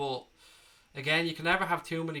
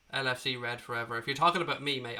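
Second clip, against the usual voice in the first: usual speaking voice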